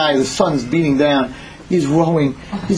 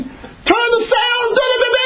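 very high, frantic yelling; panicked screaming. Acted. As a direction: scream orders over the storm. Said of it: "his brother's screaming".

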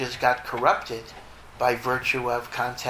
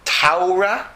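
Taura, the Torah vowel as an ow, higher and louder, rising; German Taura; 6 semitones higher, 6 dB louder than usual. Shown as a demonstration. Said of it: "if you're a German".

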